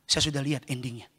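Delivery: very low, soft and unruffled; God's calm reply. Acted. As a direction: answer quietly, deep down in pitch. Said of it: "Tuhan bilang". (suara Tuhan yang tenang, rendah dan lembut)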